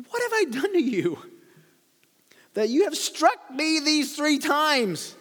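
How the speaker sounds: female donkey voice. Acted. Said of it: "this is a female voice".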